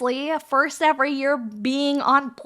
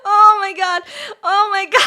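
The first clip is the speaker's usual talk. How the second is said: laughing; 9 semitones higher than usual. Real, not acted. Cracking up at own joke.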